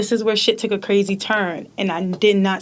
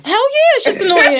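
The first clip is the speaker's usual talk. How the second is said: gleeful; 10 semitones higher, 6 dB louder than usual. Real, not acted. Gleeful loud admission.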